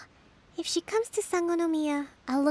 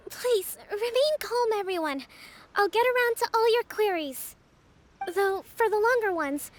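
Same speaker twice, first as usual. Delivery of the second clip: raised, higher voice to settle the crowd; calling over a crowd; 6 semitones higher than usual. Acted. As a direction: lift the voice so everyone hears, but stay gentle. Addressing a crowd of petitioners.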